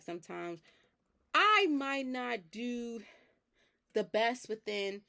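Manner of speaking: ordinary talk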